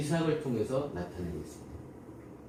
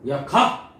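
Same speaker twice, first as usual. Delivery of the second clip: loud curt bark of an order; powerful man's command; 8 dB louder, much slower than usual. Retold or imitated. A powerful king ordering a stranger off his land, loud and curt. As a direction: bark it like someone used to being obeyed. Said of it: "권력자가".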